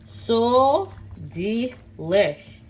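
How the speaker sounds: slow savoring so delish; drawn out delish. Real, not acted. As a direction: stretch the words, savoring. Drawn-out praise of food.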